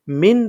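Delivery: মিন short, ending in a plain n; incorrect clear n. Shown as a demonstration. Teacher showing the mistake, মিন with a plain short n. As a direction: say মিন with no held nasal hum. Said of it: "সঠিক উচ্চারণটা কিন্তু হবে না".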